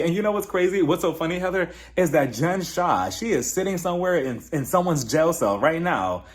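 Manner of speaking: ordinary delivery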